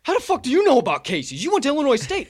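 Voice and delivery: high, loud disbelief; mock stunned friend; surprised buddy. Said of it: "he goes".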